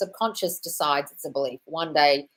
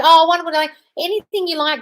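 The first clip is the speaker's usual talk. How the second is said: delighted gush; 10 semitones higher, 8 dB louder than usual. Real, not acted. Delighted reply to viewer.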